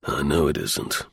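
low, steady reply. A narrator's reading. Ormiston answering his brother-in-law, low and steady. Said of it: "Ormiston said steadily".

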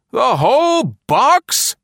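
high, slow screaming man's voice. A narrator's reading. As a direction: shriek in horror. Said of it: "he screamed".

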